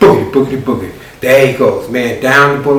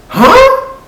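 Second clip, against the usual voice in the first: very high, loud puzzled huh; confused huh; 5 dB louder than usual. Acted. Cousin baffled by Marquette's plan, high loud huh. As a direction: squeak it out baffled.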